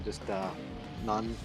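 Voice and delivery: ordinary talk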